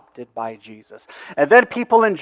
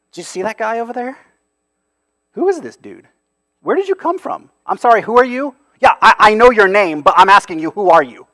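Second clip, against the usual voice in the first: mocking; 6 semitones higher, 4 dB louder, faster than usual. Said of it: "All of that's implied".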